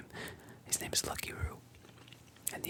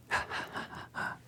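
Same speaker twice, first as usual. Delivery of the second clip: whispered bandit laughter. Acted. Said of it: "the other bandits join in laughing".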